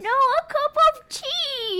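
put-on high, slow British granny accent; British grandma. Grandma correcting her grandson about tea. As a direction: correct him in a slow old British lady voice. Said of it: "She's British".

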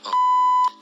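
very high, loud sudden oh; very high, loud startled oh. Retold or imitated. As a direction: gasp it out high in shock. Sudden realization on stage.

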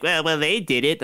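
terrible put-on impersonation; Steve Simmons. Podcaster doing his best, terrible reporter impersonation, mock defensive. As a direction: badly imitate a reporter making excuses. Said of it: "it's my best Steve Simmons imp-, impersonation".

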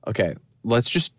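ordinary talk

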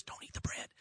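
whispered warning, barely voiced; hushed so nobody else hears; quiet whisper. Wife whispering a warning to her husband at dinner, hushed. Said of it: "she says, real quietly".

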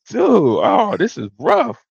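retold dismay, pitched high; mock dismay. Retelling awkward reaction to scolding.

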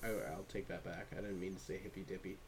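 quiet, fast mock retraction; mock apology. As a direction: hurry a fake sorry under breath. Mock apology, quick and low.

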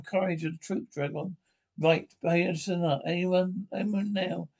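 ordinary talk, nothing marked

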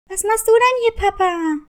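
startled to find Papa in the forest; surprised daughter. Acted. Daughter surprised to see father.

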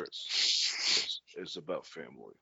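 very soft, low action-star impression; hushed, low mock-solemn catchphrase; Vin Diesel. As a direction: murmur it low, like a movie tough guy. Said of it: "Vin Diesel should be a wrestler".

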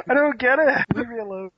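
normal talking voice

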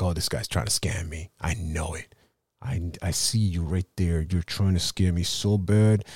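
low, wary muttering customer; a hint of a low suspicious client. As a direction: grumble like someone sure they're being scammed.

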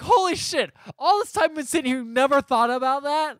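high-pitched exasperated disbelief; incredulous outburst. Retold or imitated. Recapper reacting to the old lady never thinking, incredulous. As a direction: pitch it way up, aghast.

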